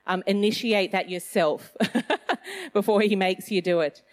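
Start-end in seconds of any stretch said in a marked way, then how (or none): 1.8-2.7 light laugh. Real, not acted. Laughs at her own joke.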